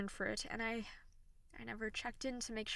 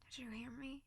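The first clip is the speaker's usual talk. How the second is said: soft remorseful whisper; sultry narrator; 8 dB quieter than usual. Acted. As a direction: whisper the apology tenderly. Whispered apology to crying listener.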